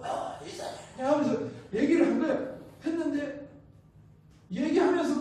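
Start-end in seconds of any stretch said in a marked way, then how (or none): 0.0-0.8 feeble whisper with hardly any voice; weak post-surgery voice. Retold or imitated. Patient starting a plea to the busy chairman, imitated weak whisper. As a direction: push out a faint, breath-only call. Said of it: "소리가 잘 안 나와요".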